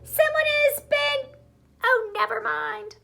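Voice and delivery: very high, small cub voice; baby bear voice. Acted. Baby Bear starts his line in a very high squeak. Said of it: "Baby Bear said".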